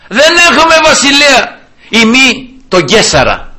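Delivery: high mob cry, easing at the end; crowd shouting. Acted. Crowd rejects their king before Pilate, shouting. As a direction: call out the refusal, then slow down. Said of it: "όλους αυτούς εκεί που φωνάζανε".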